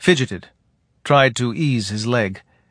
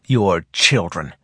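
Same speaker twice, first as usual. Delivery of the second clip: hateful; 5 semitones lower, slower than usual. Acted. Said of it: "Gossa said with hatred".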